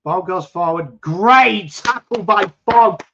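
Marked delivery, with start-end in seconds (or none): 1.0-3.0 higher, louder, slowed praise as if calling the play; excited call. Real, not acted. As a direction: savor the tackle like a commentator, loud and drawn out. Praising a tackle live.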